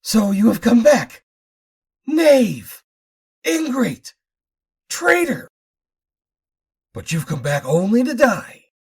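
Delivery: put-on high, loud furious old man; screaming rage. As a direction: shriek the accusations. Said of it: "screamed the old inventor".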